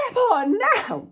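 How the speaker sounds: drawn-out, very high screech; hammed-up screech, slowly stretched; over-the-top mock scream. Passengers exaggerate demand to taxi driver, hammed-up screaming. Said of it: "Well, not like that".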